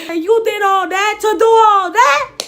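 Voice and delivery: loud, high exasperated rant; loud slow outraged demand. Acted. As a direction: shout it in disbelief.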